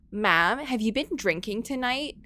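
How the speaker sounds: lightly voiced Valley Girl 911 operator; a hint of a bubbly Valley Girl dispatcher. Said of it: "the Valley Girl nine one one operator".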